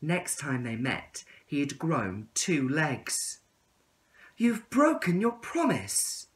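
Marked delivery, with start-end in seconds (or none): 4.4-6.3 much higher, slow, wounded reproach; hurt caterpillar. Acted. Caterpillar accusing the tadpole, high and slow with hurt. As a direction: let the hurt show in every word. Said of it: "said the caterpillar".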